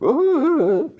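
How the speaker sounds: very high wavering "ooh"; panicked crowd noise. Retold or imitated. Hostages watch a shotgun at Matt's head, high panicked ooh. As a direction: imitate frightened onlookers wordlessly. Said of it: "People, like, freaking out".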